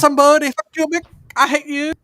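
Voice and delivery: loud high mock yell. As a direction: yell it like a cartoon insult. Mock quote of angry name.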